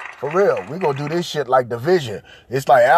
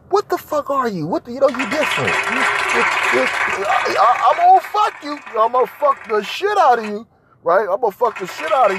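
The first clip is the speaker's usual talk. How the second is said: higher, excited come-on; lustful leer; 9 semitones higher than usual. Retold or imitated. Describing his taste in women, higher excited leer. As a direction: play a man hungrily eyeing a woman. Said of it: "I like to look and say".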